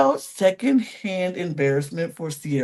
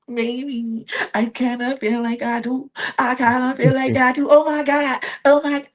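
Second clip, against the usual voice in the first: breathy put-on accent, rising into squeals; mock Bella's shifting accent; 3 semitones higher than usual. Mock Bella flirting with Nick, put-on accent. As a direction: ham up her flirty fake accent. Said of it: "how many accents do you have".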